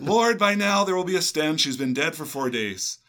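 high, loud mock-complaining Martha; whiny objection. Quoting Martha's objection to the host, hammed-up high whine. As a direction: protest it like an exasperated objector.

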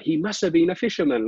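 high squeaky imitation of the baffled guy; mad theory. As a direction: squeak it out in disbelief. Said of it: "like that".

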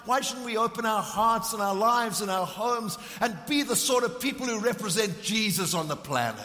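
full-force preaching. Acted. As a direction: preach it out passionately to the congregation.

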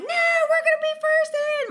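high, loud mock eagerness; young bucks hyped up. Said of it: "They're like".

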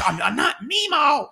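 high, slow put-on voice of a mom; squeaky, offended old-lady protest; mock mom protest. Mom insisting she's not Meemaw, high mock voice. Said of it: "I don't know if she sounds like that".

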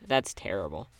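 ordinary talk, nothing marked

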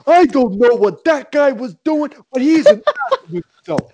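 high, loud mock Lou Holtz voice, lisping as if teeth move; hammed-up imitation of an old coach's accent; Lou Holtz impression. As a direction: Lou Holtz voice with a laugh breaking in. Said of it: "You sound just like him".

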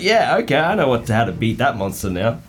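high, loud gloating; smug gamer gloat. Retold or imitated. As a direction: gloat like you just cheated. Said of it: "And you'd be like".

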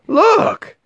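very high startled cry; astonished shout. Acted. Hunters shouting in amazement at the elephant, very high. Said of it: "they all shouted".

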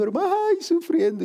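high, whiny mock lament; preacher imitating a church brother who starts to cry, very high and whiny; mock whimpering. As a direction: ham up the suffering brother about to cry. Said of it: "y empieza a llorar".

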